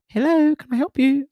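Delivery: very high, put-on receptionist voice; intercom woman. Theatre woman answers the intercom, high imitated voice. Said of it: "just speaks on the intercom".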